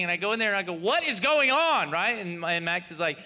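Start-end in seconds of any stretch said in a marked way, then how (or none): 0.7-1.9 mock dad yell, high and slow; retold scream. Father bursting in on screaming kids, imitated yell. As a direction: yell it word by word, acting the fed-up father. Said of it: "You're screaming".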